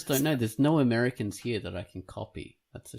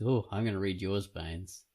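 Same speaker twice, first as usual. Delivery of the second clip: usual speaking voice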